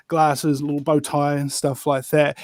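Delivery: ordinary talk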